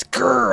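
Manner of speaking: high, loud hammed-up growl; mock angry growl. [name] growls at the crew, loud hammed-up growl. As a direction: growl like a cartoon jungle beast. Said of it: "I growl at you like an angry jungle beast".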